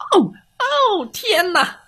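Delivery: high, squeaky cry of dismay; dismayed cat teacher. Acted. Cat teacher despairing at chicks, high wail.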